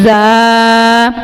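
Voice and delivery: زا with a whistling hiss; whistling letter demo. Shown as a demonstration. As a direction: let زا whistle through the teeth. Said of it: "سیٹی کی آواز نکالتے ہوئے ادا کرنا ہے". (زا سیٹی کی آواز کے ساتھ)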